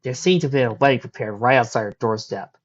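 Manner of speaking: ordinary delivery, nothing marked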